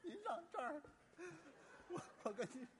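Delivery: 假哭，却忍不住笑出声; 本该哭，声音里全是笑; fake crying that sounds like laughing. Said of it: "你怎么那么高兴啊？". (说要哭，结果听着像在高兴)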